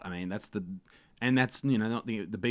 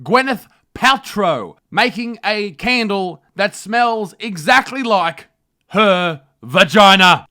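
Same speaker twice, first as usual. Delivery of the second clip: loud, high, brash parody newsreader; host a trashy news show with swagger; mock news anchor; 10 semitones higher, 16 dB louder than usual.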